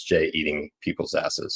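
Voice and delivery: normal talking voice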